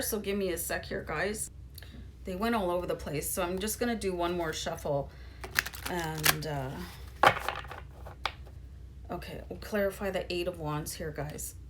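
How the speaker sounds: hushed, half to yourself, gathering spilled cards; quiet aside. Real, not acted. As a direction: talk to yourself under your breath while tidying. Muttering after dropping the deck.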